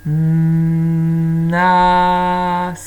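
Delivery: "Nas" with a very long loud hummed n, then long a and s; "Nas" stretched hugely from its first sound; highlighted first sound. Shown as a demonstration. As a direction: hold the opening of "Nas": nnnnn-aaa-sss. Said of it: "Écoutez bien le début".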